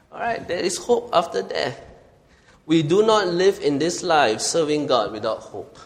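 normal talking voice

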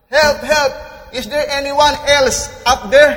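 high, loud yell upward; full-voiced, high cry for help. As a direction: shout past the first voice for another rescuer. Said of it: "Jack yelled".

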